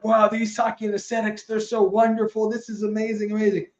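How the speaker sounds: hammed-up naive husband in raptures; clueless gushing husband. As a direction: rave like a fool who noticed nothing. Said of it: "the husband's still like".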